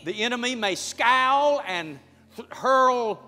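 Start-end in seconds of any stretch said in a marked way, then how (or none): none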